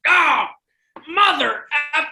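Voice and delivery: high, loud yelp of pained fury; Mav's angry outburst. Retold or imitated. Imitating coach hit in the shin by a puck, high angry yell. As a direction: impersonate a coach roaring after a hit. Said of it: "your impersonation of Mavney".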